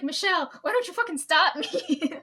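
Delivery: loud, high, laughing through it; laughing mock blame. Teasing Michelle for letting her feed her cake, loud laughing. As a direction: accuse her playfully. Joking blame at older coworker.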